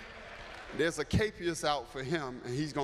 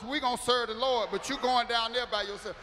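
panicked inner voice; 8 semitones higher than usual. Retold or imitated. Pastor acting out his own panic.

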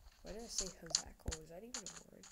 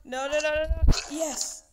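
high, loud frantic shouts; panicked shouting; 7 semitones higher, 11 dB louder, much slower than usual. Real, not acted. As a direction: yell in sudden panic. Mob attack in game.

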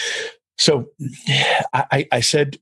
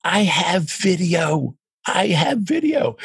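excited insistence; 5 semitones higher, much slower than usual. Retold or imitated. Excited retort to doctor.

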